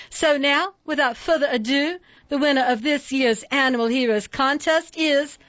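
put-on high, bright Southern-accented welcome; sugary Southern accent. A narrator's reading. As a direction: sweet-talk the crowd, Southern accent. Judge announces winner.

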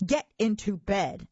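loud monster order; thundered. A narrator's reading. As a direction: bellow it as a cross monster. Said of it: "Gabe thundered".

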